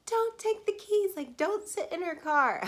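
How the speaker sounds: high, loud, alarmed warning; panicked sister. Retold or imitated. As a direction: sister on the phone warning her not to take the keys, panicked. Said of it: "thinks I'm already kidnapped".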